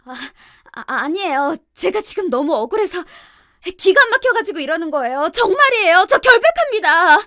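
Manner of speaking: stammering start building to a loud, high-pitched wail; tearful protest. A narrator's reading. Sister-in-law insisting she is innocent before the elders, tearful wailing. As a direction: plead innocence on the edge of sobbing. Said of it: "울먹이면서 결백을 주장한 신우이는".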